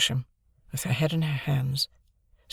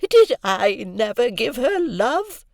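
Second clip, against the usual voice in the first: put-on slow, high, louder anguished old woman; Miss Havisham crying out; 10 semitones higher, 6 dB louder, slower than usual. A narrator's reading. Havisham to Estella, a slow anguished cry. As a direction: wail it out wounded. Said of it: "cried Miss Havisham".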